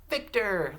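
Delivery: Victor high and loud, in one piece; high, loud Victor with a falling end; name called out. Shown as a demonstration. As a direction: call out Victor whole for the class to echo. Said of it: "say this name with me".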